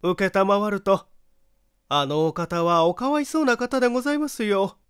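high, bright-voiced chatty housewife; gossipy neighbour wife. Neighbour wife eagerly gossips to the visitor, high chatty voice. Wife continues her story.

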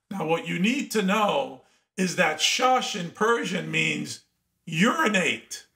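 ordinary delivery

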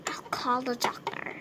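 whispered aside. Acted. Whispering a plan to call the doctor. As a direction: breathe it out unvoiced.

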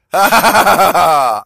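a long loud laugh. Real, not acted. After joking about riboflavin, loud laugh. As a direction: break into a hearty laugh.

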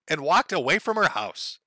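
normal talking voice

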